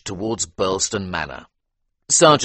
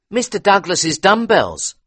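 higher, deferential butler; 13 semitones higher, 7 dB louder than usual. A narrator's reading. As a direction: answer politely as a servant. Butler answers.

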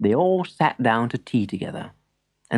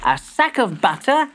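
put-on young boy voice; 7 semitones higher than usual. A narrator's reading. Boy reads list.

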